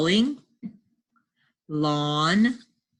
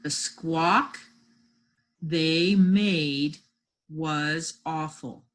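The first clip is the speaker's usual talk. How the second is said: broken into slow chunks with pauses; dictation; much slower than usual. Shown as a demonstration. Teacher dictating a sentence for spelling, slow chunks. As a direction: stretch each phrase, pausing between them.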